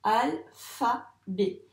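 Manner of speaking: "Alphabet" very slow, three detached syllables. Shown as a demonstration. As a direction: spell out "Alphabet" in spoken syllables with pauses. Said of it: "Allez, on corrige". (« Alphabet » très lentement, syllabe par syllabe)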